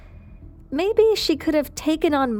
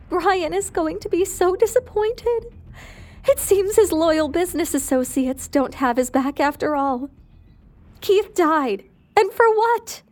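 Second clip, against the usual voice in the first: bitter grieving widow; 6 semitones higher than usual. Acted. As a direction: let the grief break out higher on the last words. Grieving widow voices her bitterness.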